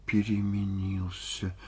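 hushed, breath-filled sigh; slow, near-whispered hurt; sighed reproach. A narrator's reading. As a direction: sigh it softly, with long pauses. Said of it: "вздохнула Ольга Ивановна".

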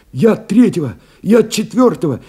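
высокий, взволнованный голос в трубке; лихорадочная скороговорка связиста. A narrator's reading. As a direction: call in urgently and high, as a feverish field radio voice. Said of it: "лихорадочно чистивший голос". (лихорадочный, высокий голос связиста)

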